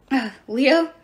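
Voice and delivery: hesitant, uneasy query; mildly anxious questioning; nervous. A narrator's reading. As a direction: ask with a touch of worry. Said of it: "Jason asked nervously".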